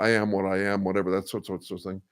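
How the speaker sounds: ordinary delivery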